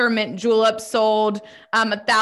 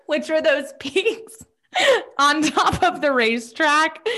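laughing; 7 semitones higher than usual. Real, not acted. Laughs while explaining.

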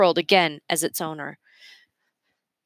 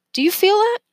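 excited; 4 dB louder than usual. A narrator's reading. Boy asks in wonder.

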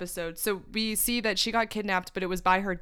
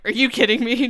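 louder, indignant mock dad's disbelief; 3 semitones higher, 9 dB louder than usual. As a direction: play the dad, fed up and incredulous. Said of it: "the dad is like".

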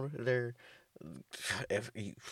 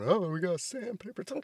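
mock cat voice; 11 semitones higher than usual. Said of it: "Fucking cats going".

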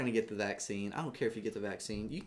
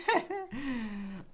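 high laugh. Real, not acted. Laughing at the tails joke.

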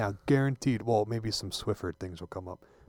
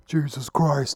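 louder, raised Austin-style exclamation; belted-out impersonated oath; Austin impersonation; 4 semitones higher, 7 dB louder, much slower than usual. Acted. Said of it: "That's my Austin impersonation".

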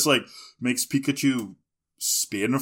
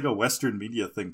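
normal talking voice

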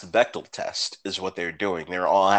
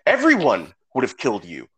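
exasperated rant; 6 semitones higher, faster than usual. Retold or imitated.

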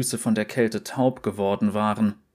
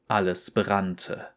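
usual speaking voice

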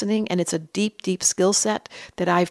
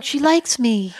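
put-on smitten baby talk; 5 semitones higher, slower than usual. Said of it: "you're like".

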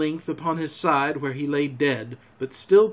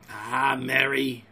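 taunting; 3 semitones lower, slower than usual. A narrator's reading. Silver coolly taunts his accuser.

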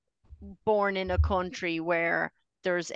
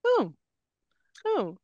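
higher awed exclaim; impressed whoa; 9 semitones higher, slower than usual. Retold or imitated. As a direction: retell being wowed by the screen. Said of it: "I frequently would go".

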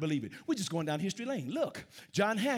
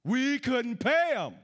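louder, slow and punched; emphatic; 5 semitones higher, 5 dB louder, slower than usual. Real, not acted. Preacher insists to the congregation about Congress, loud and slow. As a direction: hammer it home slowly.

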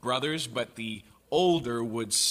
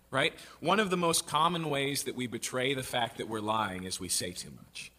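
ordinary talk, nothing marked

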